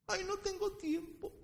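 high, whiny mock excuse voice; whiny churchgoer. Pastor mocking a churchgoer's excuse, high whiny voice.

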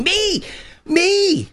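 put-on very high, excited kid volunteering; eager kid. Preacher acting Isaiah jumping up and down, eager kid. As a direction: plead to be picked like a front-row kid. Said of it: "becomes that eager kid in the front row".

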